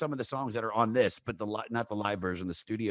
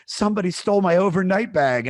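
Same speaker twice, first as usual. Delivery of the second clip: higher, louder mock distraught wail; retold crying girlfriend; 9 semitones higher, 9 dB louder than usual. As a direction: play her upset loss, hammed-up. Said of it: "she's, like, crying".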